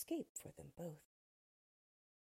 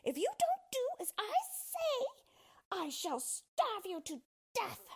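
high, strident witch's shout; high-pitched, slowing menace from a witch; shouted threat; 3 dB louder than usual. A narrator's reading. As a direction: shout a threat from a safe distance. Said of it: "shouted at him".

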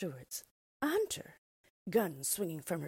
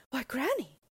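higher, louder, full of wonder; wondering exclamation; 7 semitones higher, 4 dB louder than usual. A narrator's reading. Little girl startled by granny's ears, wondering aloud. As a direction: gasp it out in surprise. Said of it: "she exclaimed wonderingly".

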